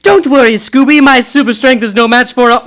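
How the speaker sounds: high, loud heroic Supergirl voice; a superheroine calling out in alarm; high heroine voice. Acted.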